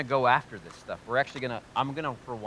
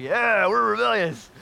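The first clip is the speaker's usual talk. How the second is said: much higher, louder, slow swaggering voice; mock proud rebel; 11 semitones higher, 13 dB louder, slower than usual. Pastor mimicking rebellious kids bragging, high and slow. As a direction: play a smug rebel bragging.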